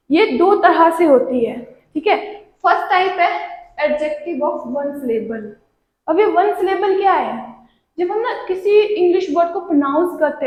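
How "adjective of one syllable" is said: slow, softer rule heading; slow rule title. Shown as a demonstration. Teacher reading the rule title, slow and soft. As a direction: read the rule title slowly. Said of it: "rule title read slowly".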